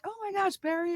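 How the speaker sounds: high-pitched, soft delighted squeal of recognition; delighted greeting. Retold or imitated. Retelling running into an old boss at dinner, delighted high greeting.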